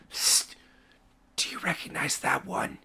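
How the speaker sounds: hushed, unvoiced aside; furtive whisper. Guard checks with the other guard about the symbol, furtive whisper. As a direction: whisper it covertly to a colleague.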